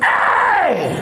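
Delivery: high sudden "hey"; startled yelp. Retold or imitated. Mute bloke yelping at the doctor, high startled. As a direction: yelp like you've been jabbed.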